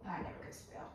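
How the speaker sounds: breathy whisper to yourself; whispered aside. Real, not acted. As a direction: whisper it under your breath. Repeats spelling joke aside.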